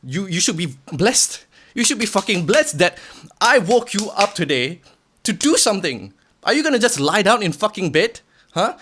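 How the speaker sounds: the harsh inner critic yelling; angry inner voice. Retold or imitated. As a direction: tear into your lazy self. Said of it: "Hamka number two is like".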